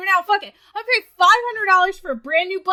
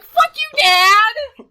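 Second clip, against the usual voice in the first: mock outburst at dad; 6 semitones higher, 7 dB louder, much slower than usual. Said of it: "And I'm like".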